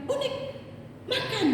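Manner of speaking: mother-in-law's high, abrupt call to eat; high-toned order. Retold or imitated. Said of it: "Kok nada suaranya agak tinggi gitu".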